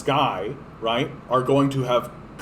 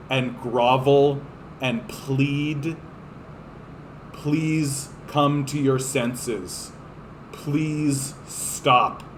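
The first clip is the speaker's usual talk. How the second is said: slow pleading; much slower than usual. Pretend. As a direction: plead slowly as if on your knees. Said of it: "we are going to have to beg".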